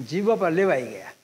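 usual speaking voice

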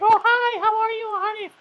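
very high mock-mom greeting; squeaky, hammed-up visitor voice; visiting mother. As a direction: coo a greeting in falsetto. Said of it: "so we're gonna be like".

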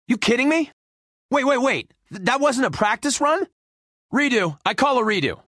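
high-pitched protest; indignant disbelief. Acted. Loser disputing that it counted, indignant disbelief. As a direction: protest the loss and demand another go. Protests losing, demands redo.

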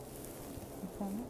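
whispered, slow echo of the greeting. Real, not acted. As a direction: breathe it back under your breath.

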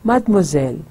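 mademoiselle। run together fast as matmozel; quick joined. Shown as a demonstration. Teacher shows mademoiselle at natural speed. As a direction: rattle off mademoiselle। in one breath. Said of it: "इकट्ठा तेज़ी में बोलती हूँ".

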